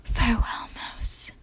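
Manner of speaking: soft breathy farewell; whisper. A narrator's reading. Dying bear bidding the mouse goodbye, whispered. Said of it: "Bear's voice dropped to a whisper".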